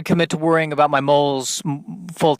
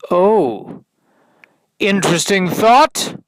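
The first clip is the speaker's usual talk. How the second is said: higher, louder mock-excited oh; mock intrigue; 6 semitones higher, 5 dB louder, slower than usual. Comic mocks his own idea with fake intrigue.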